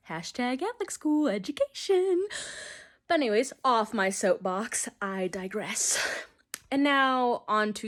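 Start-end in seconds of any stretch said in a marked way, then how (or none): 0.1-2.3 high, playful hashtag voice; mock hashtag. Joking to subscribers, high mock hashtag. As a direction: toss it off like a caption.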